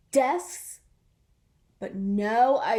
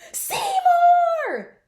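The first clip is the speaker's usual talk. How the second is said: much higher, much louder frantic yell; scream; 9 dB louder than usual. Acted. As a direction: scream the lost friend's name in panic. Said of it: "Splat screamed".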